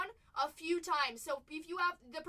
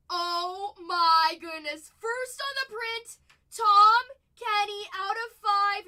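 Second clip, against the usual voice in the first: loud, slow, higher shout of joy; excited shout; 6 semitones higher, 14 dB louder, slower than usual. Real, not acted. Shouting to chat after pulling a rare autograph card, excited. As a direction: yell it out in disbelief at the pull.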